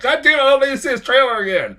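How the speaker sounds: high, loud, fast exasperated cry; shrill-free high outburst of frustration; frustrated mock yell. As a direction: whine it out, fed up with the trailer.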